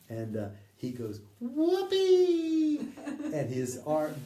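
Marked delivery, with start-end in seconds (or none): 1.4-2.8 very high, loud, drawn-out whoop; imitated whoopee scream. Man leaps through waterfall into light, imitated screaming whoop. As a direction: imitate a gleeful yell at full lung. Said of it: "screaming "whoopee" at the top of his lungs".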